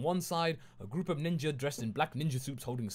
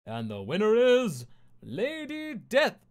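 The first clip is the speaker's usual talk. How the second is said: much higher, louder, slow arena announcement with a rise; announcer call; 10 semitones higher, 9 dB louder, slower than usual. A narrator's reading. The voice announcing the fight's winner, higher and louder. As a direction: boom it out like a ring announcer.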